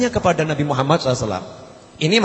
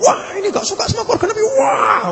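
much higher, shouting wail of mock outrage; outraged wailing cry. Preacher mimics a zealot denouncing others, high shouting wail.

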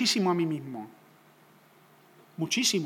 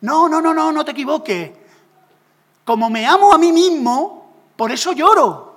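much higher and louder, wailing protest; 7 semitones higher, 11 dB louder than usual. Pretend. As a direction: cry out in hammed-up self-pity. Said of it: "Y entonces tú me dices". (Julio protestando entre lloros, gritando exagerado)

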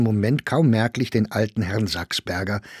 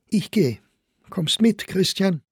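high woman's voice, curt command; like an order; 10 semitones higher than usual. A narrator's reading. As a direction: toss it at him as an order. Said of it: "zu wie einen Befehl". (hoch, knapp, wie ein Befehl)

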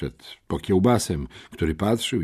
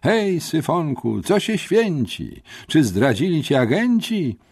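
high, drawn-out taunting rhyme; spiteful declamation; 8 semitones higher than usual. A narrator's reading. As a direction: recite it mockingly at your victim, pitched high. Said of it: "zadeklamował złośliwie". (złośliwa, wysoka deklamacja)

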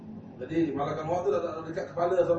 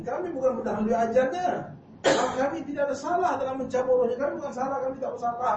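high, emphatic denial; 8 semitones higher, 5 dB louder than usual. Acted. Angel protests innocence.

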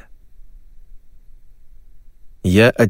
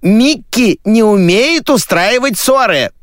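high, slow, loud complaint; barrage of shouting; 13 semitones higher, 5 dB louder, slower than usual. Acted. Mother gossiping over another caller, shouting. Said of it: "Два женских голоса перемешались ... слились в одну канонаду".

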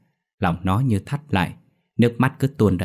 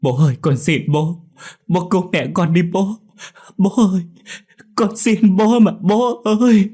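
desperate sobbing plea; 11 semitones higher, 5 dB louder than usual. A narrator's reading. Dậu begging his father for help, wailing. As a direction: implore father with everything you have. Boy begs father to save mother.

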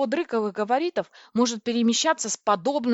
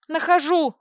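high, loud yelp of agreement; frantic shouted answer; yell; 8 semitones higher, 6 dB louder than usual. A narrator's reading. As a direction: yell it back urgently. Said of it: "завопила я".

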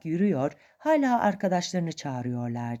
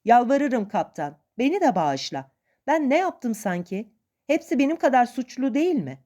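higher voice, lightly acted begging; understated desperate appeal; mild pleading; 6 semitones higher, 3 dB louder than usual. A narrator's reading. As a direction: plead for your life, protesting. Atkins begs captain for mercy.

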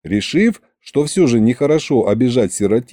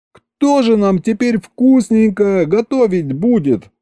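high, horrified wail; wailing yell; 10 semitones higher than usual. A narrator's reading. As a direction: howl in comic despair at a high pitch. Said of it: "завопил на весь дворец".

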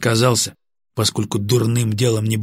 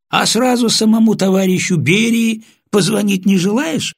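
sarcastic mock question; 11 semitones higher, 5 dB louder than usual. Особист насмешливо переспрашивает арестанта.

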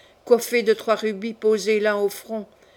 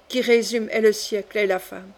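ordinary delivery

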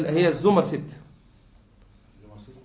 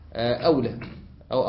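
usual speaking voice

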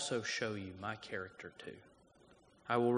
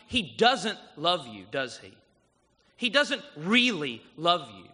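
serpent's sneer; 4 semitones higher, 8 dB louder than usual. Acted. Voicing the serpent's lie.

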